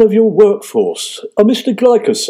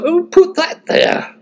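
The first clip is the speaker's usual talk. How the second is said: mock indignant; 9 semitones higher, slower than usual. Quoting himself after falling.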